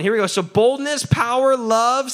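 ordinary talk, nothing marked

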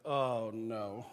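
low, sinking aside of dread; dismayed. Retold or imitated. Pastor realizes he has been recognized, low dismay. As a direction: groan it inwardly, dropping low.

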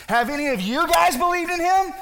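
loud, high demanding question; indignant challenge. Acted. Spokesman steps up to question the Pharisees, indignant. Chief priest challenges group, acted.

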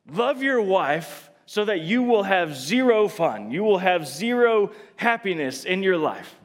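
imagined killjoy God laying down the law; emphatic, mildly hammed-up decree; mock harsh command. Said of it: "It's not like God was like".

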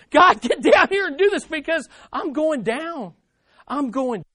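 high, loud, urgent plea; desperate. Shown as a demonstration. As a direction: beg for rescue as if going under. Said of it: "from a place of desperation".

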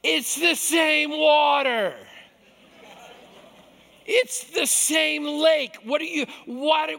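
loud, slow, high disbelief; exasperated mock outburst; incredulous shout. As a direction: yell it like it's absurd. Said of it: "I'm like".

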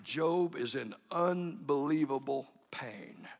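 normal talking voice

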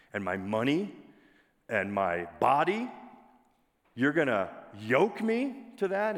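mock-indignant questions, each one rising at the end; acted-out affronted pushback; incredulous protest. Acts out moderns balking at Jesus.

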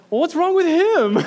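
wife Leah baffled at the salesman, high and loud; wife's retort. Retold or imitated. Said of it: "And Leah said".

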